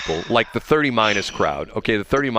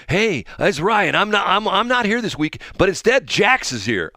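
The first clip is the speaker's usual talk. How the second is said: Ryan Seacrest impression; 7 semitones higher than usual. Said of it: "it's like Ryan Seacrest".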